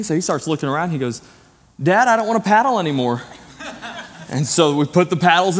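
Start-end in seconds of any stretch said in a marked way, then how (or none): 1.8-3.2 put-on high, loud, fast child's whine; whiny kid. Pastor imitating his son complaining to dad on the raft, high fast whine.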